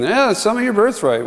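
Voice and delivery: exaggerated youth. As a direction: tease like a cocky boy. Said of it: "over-exaggeration of youth speaking".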